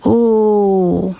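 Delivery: Oh as one long held vowel; isolated O sound. Shown as a demonstration. Teacher isolates the rhyme sound, a long sustained Oh. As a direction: hold a steady, drawn-out Oh. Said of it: "le dernier son de la dernière syllabe".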